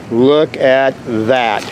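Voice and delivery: "Look at that", each word drawn out; slow emphasis. Real, not acted. Same words repeated for emphasis.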